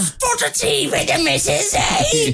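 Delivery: put-on very high, hammy cockney English accent. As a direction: ham up a stage cockney. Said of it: "Do an English accent".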